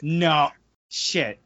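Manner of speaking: ordinary talk, nothing marked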